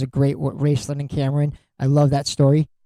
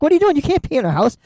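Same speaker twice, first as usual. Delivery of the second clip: mock-panicked friend, voice jumping much higher; 9 semitones higher, much faster than usual. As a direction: squeal it like a friend freaking out. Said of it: "He's like".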